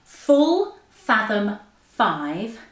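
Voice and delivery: very slow, separated, swooping words; tongue-twister demo. Shown as a demonstration. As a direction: articulate each word by itself with big intonation. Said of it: "do it with me".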